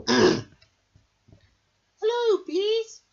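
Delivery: high, bright queen bee voice. Acted. The queen bee greets her pupils at school. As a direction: greet the class like a grand queen. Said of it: "said the queen".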